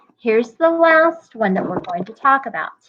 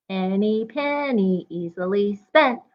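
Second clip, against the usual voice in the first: usual speaking voice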